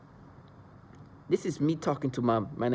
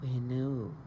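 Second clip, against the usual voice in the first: forced calm hypnotic voice; 6 dB quieter, slower than usual. Retold or imitated. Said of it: "they start talking like that".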